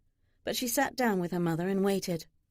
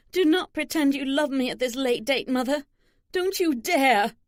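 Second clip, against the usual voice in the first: higher, louder acted indignation; angry rebuke; 5 semitones higher, 5 dB louder than usual. A narrator's reading. Daughter rejecting her mother's claim of love, angry. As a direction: forbid it angrily, slowing hard on the last words.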